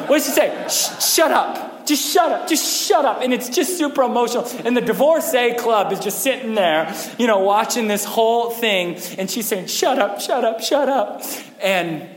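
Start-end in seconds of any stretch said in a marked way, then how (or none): 0.7-3.2 high, loud, overwrought shut up; mock emotional outburst. Pastor acting out the wife silencing her husband, hammed-up shout. 9.7-11.2 mock emotional shut up. Wife repeating her line.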